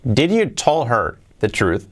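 slow, deliberate reading of the wrong sentence. Shown as a demonstration. Teacher reading out the mistaken sentence slowly. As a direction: read the example slowly for learners. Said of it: "Esta que hay aquí".

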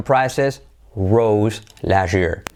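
"prices rose last year" slowly, word by word; drawn-out example, last year run together; slow model sentence. Shown as a demonstration. Teacher giving the past example prices rose, slow and careful.

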